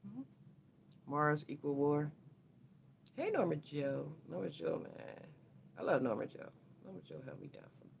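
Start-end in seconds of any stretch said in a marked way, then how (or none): none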